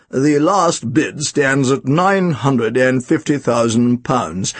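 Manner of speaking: slow, drawn-out auctioneer voice; laboured, halting bid announcement; stammering auctioneer. A narrator's reading. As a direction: read out the bid slowly and unsurely. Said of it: "he stammered".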